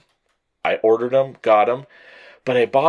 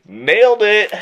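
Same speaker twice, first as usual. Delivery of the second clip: smug triumph; 9 semitones higher, 5 dB louder than usual. Retold or imitated. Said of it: "I was like".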